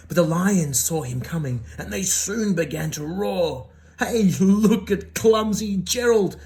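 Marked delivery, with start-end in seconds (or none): 4.0-6.4 loud, slow mocking taunt; jeering animals. A narrator's reading. Lions taunting Gerald at the dance, loud jeer. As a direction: jeer at him like a bully. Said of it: "the animals all laughed".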